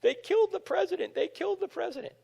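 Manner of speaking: high, soft, mildly tearful mother; a touch of a weeping mom repeating the news; grieving mother. Retold or imitated. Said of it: "She said".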